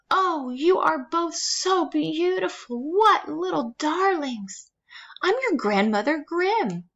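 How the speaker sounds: high, slow, gushing granny voice; doting old grandmother. A narrator's reading. Grandmother meeting the girls, high doting voice. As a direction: gush over the girls like a sweet old granny. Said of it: "with a hint of a German accent".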